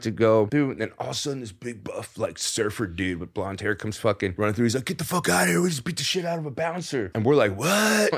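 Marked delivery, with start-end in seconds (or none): 4.8-7.1 higher, panicked retold warning; frantic yell. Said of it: "he was so frantic and tripped out". 7.6-8.1 sharply higher, startled shout; shocked what. Retold or imitated. Shocked reply to the warning.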